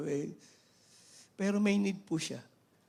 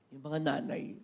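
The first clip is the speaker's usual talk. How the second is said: ordinary talk, nothing marked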